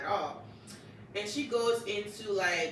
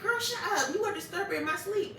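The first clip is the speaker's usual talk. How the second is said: high-pitched, playful telling-off; mock motherly scolding; 10 semitones higher than usual. Mother telling her daughter to stop disturbing sleep, mock scolding. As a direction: scold like an irritated mother, hammed up.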